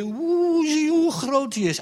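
high, loud frightened awe; scared gasp. Acted. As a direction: gasp at the giant's size. Frightened Israelites see giant.

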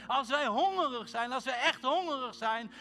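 loud, high fervent exhortation; preaching at full force. Real, not acted. Preacher urging the church to cry out, loud. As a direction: whip the room up.

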